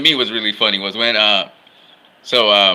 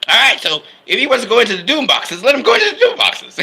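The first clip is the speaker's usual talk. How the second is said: high, laughing mock Barry voice; hammed-up Flash, half laughing; hammed-up Barry, high and laughing, brushing off the danger; Barry's crazy voice; 11 semitones higher, 3 dB louder, faster than usual. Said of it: "Barry with his crazy voice say".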